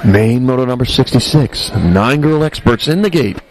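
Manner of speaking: low, hushed golf commentator delivery. Shown as a demonstration. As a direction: announce it like a hushed golf broadcast. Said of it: "That was my best golf voice".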